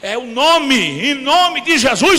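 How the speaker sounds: rising shout at the brother; scolding shout. Retold or imitated. Preacher yelling at a brother to cast out the demon. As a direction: yell the correction and build to the name of Jesus.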